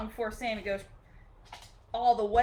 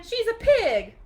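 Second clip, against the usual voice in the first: indignant Eugenia; 11 semitones higher, 7 dB louder, slower than usual. A narrator's reading. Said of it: "said Eugenia".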